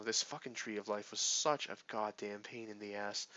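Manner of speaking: normal talking voice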